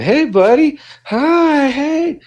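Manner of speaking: put-on high, excited baby-talk greeting to a dog; gushing dog greeting. Mimicking people greeting a dog.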